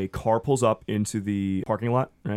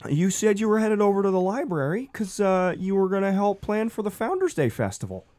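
very high, patient reminder; reproachful husband; 9 semitones higher than usual. Acted. Gary reminding Dolores of the committee, very high. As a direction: list her broken promises gently.

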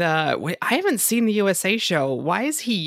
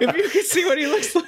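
laughing, pitched up; giggling through the line; 12 semitones higher than usual. Real, not acted. Laughing at the invisible man joke.

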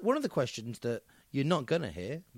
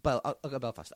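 normal talking voice

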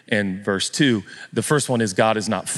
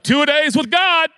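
mock coach; 5 dB louder than usual. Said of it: "You're like".

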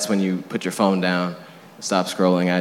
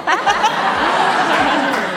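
sudden high-pitched laugh, louder; high burst of laughter; 6 dB louder than usual. Real, not acted. Delighted at easy question.

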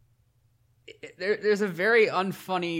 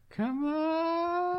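high pleading come on; 13 semitones higher, 5 dB quieter than usual. Real, not acted. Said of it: "Do it right now".

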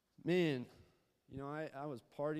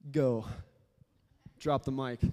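joking, louder mock sermon with a mic drop; mock one-minute sermon; 8 dB louder than usual. Youth pastor joking about a one-minute sermon, loud mock preaching. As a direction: ham up a tiny sermon, then drop the mic. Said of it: "I could do a one-minute sermon".

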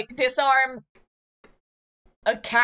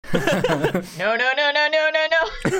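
louder, rapid-fire string of no's; panicked no's climbing in pitch; mock panic; 4 semitones higher, 4 dB louder, slower than usual. [name] rushing in to the ticking catapult, playful panic.

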